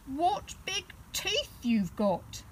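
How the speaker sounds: slow, high and frightened; slow, high, scared little bear. Acted. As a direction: ask it slowly, scared of the answer. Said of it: "feeling very scared now".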